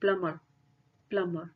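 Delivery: "प्लमर" said alone, B left out; silent B. Shown as a demonstration. Teacher repeats the word for students, "प्लमर" with silent B. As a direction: model "प्लमर" as a separate word, only an M in the middle. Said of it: "इसको इस तरीके से बोलते हैं".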